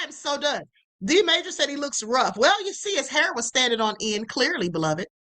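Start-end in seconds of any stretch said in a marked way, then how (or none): none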